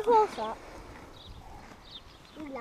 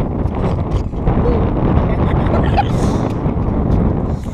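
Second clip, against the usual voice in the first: excited laughter; 4 semitones higher, 14 dB louder than usual. Real, not acted.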